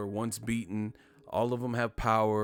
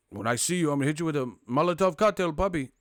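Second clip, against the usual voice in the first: mock threat from an angry fan, louder and higher; hammed-up fan threat; 4 semitones higher, 5 dB louder than usual. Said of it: "The Puerto Ricans and shit was trying to".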